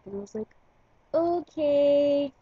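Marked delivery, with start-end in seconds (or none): 1.1-2.3 drawn-out, higher, louder "okay"; doubtful okay. Retold or imitated. Replying to his denial about the lost keys, doubtful. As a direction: stretch out a sceptical okay.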